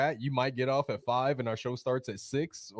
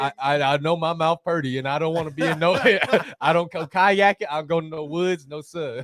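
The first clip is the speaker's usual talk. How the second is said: put-on hillbilly accent; 4 semitones higher, 7 dB louder than usual. Answers joke in hillbilly voice.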